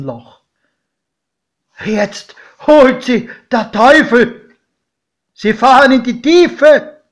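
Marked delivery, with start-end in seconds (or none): 1.8-7.0 godfather screams. A narrator's reading. Godfather sees the train vanish into the tunnel, terrified scream. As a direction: shriek that the devil takes them. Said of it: "schrie mein Pate".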